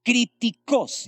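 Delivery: Críticos slowly, stress on the O; Críticos, stressed on the O and drawn out; Greek word pronounced. Shown as a demonstration. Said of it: "con tilde en la segunda, en la O".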